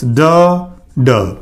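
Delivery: "Duh, duh" as two separate d sounds, the second falling low; teacher demonstrating the repeated "duh" sound; isolated d sound. Shown as a demonstration. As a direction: sound out the d twice with a pause between "Duh" and "duh". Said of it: "Again repeating".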